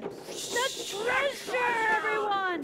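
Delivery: captain's yell. Acted. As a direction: wail it over the storm. Captain wailing in storm.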